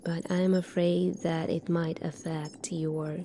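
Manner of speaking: usual speaking voice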